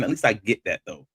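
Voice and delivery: normal talking voice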